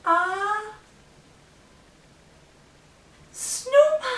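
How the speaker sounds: slow, then a squealed high "snowman"; delighted surprise for the kids; excited reveal. Acted. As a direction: draw it out and burst on the reveal. Building reveal to children.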